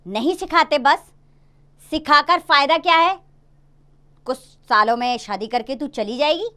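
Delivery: stern refusal. Acted. Father flatly refusing his daughter, a hint of irritation. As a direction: shut her down firmly.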